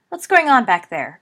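loud, alarmed call; alarmed cry. A narrator's reading. Teacher calling to the back of class, alarmed. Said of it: "cried an alarmed voice".

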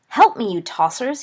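loud cry for help from a losing fight; desperate shout at his friends. A narrator's reading. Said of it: "he shouted at his friends".

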